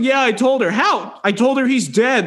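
a high mock of Zed; imitating Zed. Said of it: "She's like".

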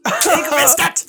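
very high, loud, drawn out slowly; slow, shrieked told-you-so; triumphant. Real, not acted. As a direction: crow that you called it. Host's twist lands.

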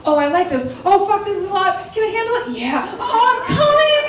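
hammed-up escalation, pitch rising line by line; building mock excitement. Storyteller re-enacting her reaction, building to a high cry.

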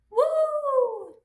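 long, high, loud "woo"; high celebratory holler; excited whoop. Real, not acted. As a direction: let out a drawn-out, high whoop. Cheering as photo is taken.

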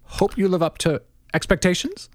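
a hint of McGowan reading his cable; McGowan impression. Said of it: "you're the one who can do the McGowan".

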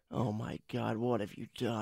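a mock-aghast low hush; horrified wife. Wife horrified at husband's remote trick, low hushed murmur. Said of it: "Kate just shoots me this look like".

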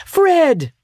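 high eager call of a name; joyful call from the doorway; calling Fred. A narrator's reading. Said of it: "said Scrooge".

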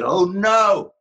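sudden high, loud cry of alarm; dismayed cry. Retold or imitated. Wife discovering a typing mistake, a high loud "oh no". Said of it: "all of a sudden she said".

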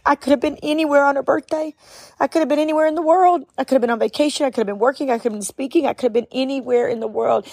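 tearful and high, rushing; choked up, voice high; crying. Real, not acted. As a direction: through tears, words tumbling out. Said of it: "I start to cry like this".

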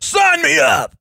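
high-pitched, hammed-up Southern holler; eager Southern yokel yelling out; dumb Southern accent shout. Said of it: "make them sound so dumb with the Southern accent".

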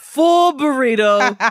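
loud, slow, laughing exclamation; playful shout. Real, not acted. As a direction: call it out big and slow. Joking about hogging blankets.

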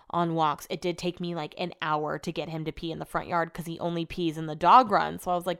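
normal talking voice

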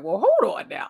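surprised double take. Retold or imitated. Suddenly seeing the truth.

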